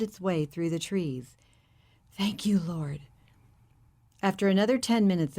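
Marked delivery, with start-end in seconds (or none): 2.1-3.0 breathy thankful whisper under the breath; a hushed thank-you to God; whispered prayer. Acted.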